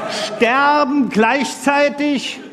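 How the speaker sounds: a slow, weighty storyteller beat; slow dramatic telling. A narrator's reading. Telling the hacker joke, slow dramatic pause. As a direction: stretch sterben gleichzeitig out slowly for effect.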